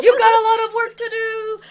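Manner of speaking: high, loud call; loud teacher's heads-up, pitched high. Acted. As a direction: call it out over the others. Teacher calls back to student.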